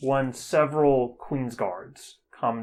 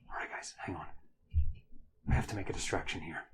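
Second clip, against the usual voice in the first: hushed; 5 semitones lower, 9 dB quieter, much faster than usual. Acted. Hiding, planning a distraction.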